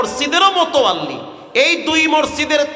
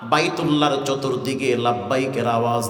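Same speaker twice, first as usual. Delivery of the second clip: melodic waz preaching; 10 semitones lower, 5 dB quieter than usual. Acted. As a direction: intone the life story on a low note. Tuneful sermon storytelling. (নিচু সুরে ওয়াজ)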